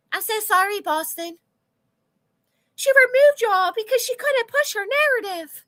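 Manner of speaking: sing-song fake sympathy; high mocking apology. Real, not acted.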